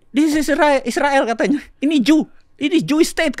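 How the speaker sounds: high, loud quoted retort. Retold or imitated. Quoting the teller who scolded him, high and loud. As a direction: throw back her retort.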